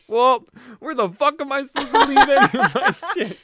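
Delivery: high, loud exasperated retort with a laugh; mad retort. Retold or imitated. Answering the sister at the bar, laughing exasperated snap. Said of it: "so fucking mad".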